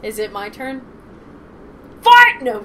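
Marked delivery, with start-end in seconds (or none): 2.0-2.4 shouting. Real, not acted. Sudden joke shout.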